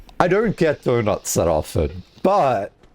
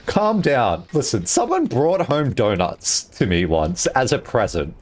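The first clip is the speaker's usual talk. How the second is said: ordinary talk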